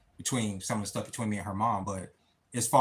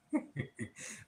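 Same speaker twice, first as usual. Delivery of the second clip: breathy laugh; 4 semitones higher, 11 dB quieter than usual. Real, not acted. Embarrassed at nearly crying live.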